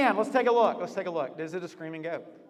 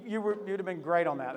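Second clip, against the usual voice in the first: normal talking voice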